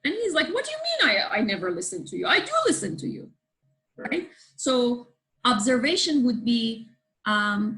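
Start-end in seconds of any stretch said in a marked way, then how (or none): 0.5-3.3 husband getting defensive. Retold or imitated. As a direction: protest like you're wrongly accused. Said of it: "He gets defensive".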